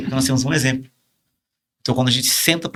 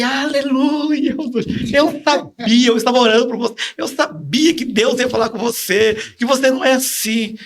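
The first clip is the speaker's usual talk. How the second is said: high, loud, drawn-out rejoicing; retold jubilant outcry; 12 semitones higher, 3 dB louder, slower than usual. Man retelling pastor's joy on the phone, exultant. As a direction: exclaim it like answered prayer, pitched way up.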